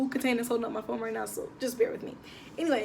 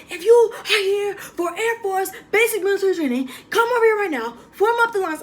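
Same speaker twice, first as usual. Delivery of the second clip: much higher and louder, barking orders like a drill sergeant; drill instructor yelling; 9 semitones higher, 7 dB louder than usual. Retold or imitated. Female instructor orders new recruits to form lines, loud imitated yell. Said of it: "she was yelling".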